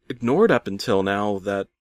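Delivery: plain reading voice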